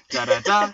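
"da da DA", loud on the last beat; two light "da", then land on the third; anapest rhythm. Shown as a demonstration. Husband beating out anapests for co-host, anapest rhythm. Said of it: "unstressed, unstressed, stressed".

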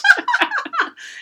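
high bright burst of laughter. Real, not acted. Laughing at own joke.

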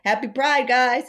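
loud, high celebratory greeting; imitated party cheer. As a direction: call it out to the whole party. Acting out brother greeting at party.

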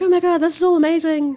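dazzled by the big city; a touch of breathless wonder; awestruck gush. Retold or imitated. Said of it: "Alana's like".

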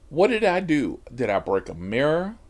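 usual speaking voice

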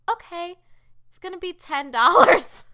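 high, very loud mock cashier; cashier quote. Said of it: "she was like".

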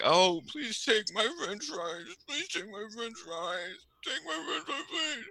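soft high mock plea; a lightly hammed-up soft plea to the family; pleading seller. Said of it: "be like".

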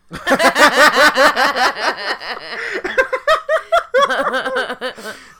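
high wheezing howl of laughter; helpless shrieking laughter; long loud laugh. Real, not acted. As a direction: lose it completely laughing. Laughing at tree joke.

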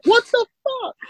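very high squeaked disbelief; shocked nurse. Retold or imitated.

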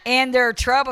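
loud, stretched scripture reading; full-force preaching. Acted. Preacher reading scripture to congregation, full-force preaching. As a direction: belt out the verse.

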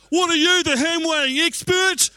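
high, loud mock indignation; indignant retort. Husband snapping back at his wife over the ham, indignant and loud. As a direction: scoff at her as if she's absurd.

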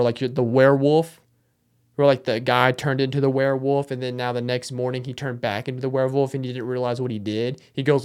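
ordinary talk, nothing marked

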